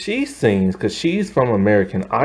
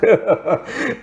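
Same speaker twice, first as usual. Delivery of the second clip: short real laugh; 6 semitones higher than usual. Real, not acted. Laughs at the movie gag.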